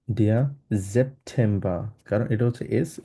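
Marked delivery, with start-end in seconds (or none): 0.8-1.8 সেপ্টেম্বর slowly as "zep-tem-ba". Shown as a demonstration. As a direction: begin সেপ্টেম্বর with a buzzing Z, drop final R. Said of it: "আমরা সেটের মতো উচ্চারণ করব". (সেপ্টেম্বর-কে ধীরে "জেপ্টেম্বা")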